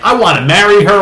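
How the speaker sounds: high, loud smitten outburst; mock lovestruck excitement. Sam walking home after meeting the lady, mock-smitten. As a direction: gush like a man instantly in love.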